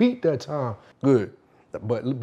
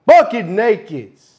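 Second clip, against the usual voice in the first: loud, higher, drawn-out outburst; sudden loud punchline; 6 semitones higher, 8 dB louder, slower than usual. Acted. Storyteller hitting the nudity detail, sudden loud burst.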